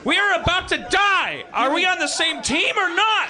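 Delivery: panicked shout. Acted. Acted bunker quarrel.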